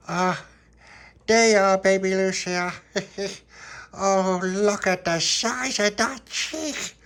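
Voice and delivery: loud, high, drawn-out cooing; gushing over baby. Acted. Little Pappy greeting baby Lucia, gushing loudly. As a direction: fuss noisily over an adored baby.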